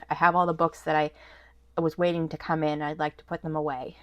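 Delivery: normal talking voice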